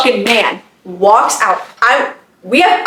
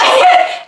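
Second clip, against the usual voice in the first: giggle. Real, not acted. Laughs remembering him asking.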